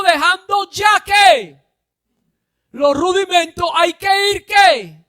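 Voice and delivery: loud, high preacher's shout; preaching at full force. Acted. Preacher shouting the point to his congregation. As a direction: drive it home to the congregation.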